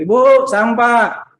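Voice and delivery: imitated street call, high, loud and drawn out. Garbage collectors calling Ibu for rubbish, drawn-out shout. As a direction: holler for the lady of the house. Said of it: "mereka teriak".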